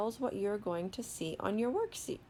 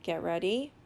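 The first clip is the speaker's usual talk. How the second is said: ordinary talk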